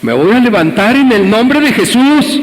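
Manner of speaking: más agudo, solemne y enfático; proclámalo como un creyente piadoso; tono religioso. Retold or imitated. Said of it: "empezó a volverse religioso". (el caído se pone religioso, voz alta y solemne)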